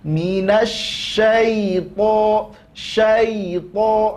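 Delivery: slow chanted Minasyaito; Quran recitation. Shown as a demonstration. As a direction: recite it as the model. Recites for students. (bacaan tartil pelan)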